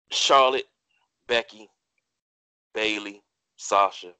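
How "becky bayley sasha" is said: ordinary delivery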